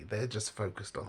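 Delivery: ordinary delivery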